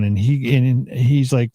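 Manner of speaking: ordinary talk, nothing marked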